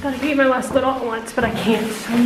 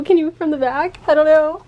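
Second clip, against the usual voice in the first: ordinary talk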